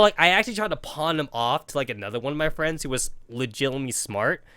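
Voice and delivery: ordinary talk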